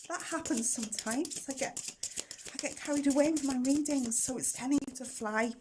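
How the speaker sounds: usual speaking voice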